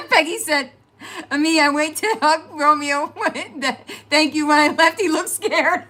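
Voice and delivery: reads aloud, laughing high and loud; laughing while reading. Real, not acted. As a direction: read it through your giggles. Reading comment through laughter.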